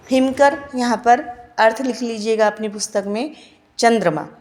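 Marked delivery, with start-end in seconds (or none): none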